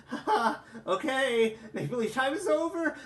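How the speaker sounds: high manic laugh then shout; manic laughter. Acted. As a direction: crack into hysterical laughter. Karkat's hysterical outburst.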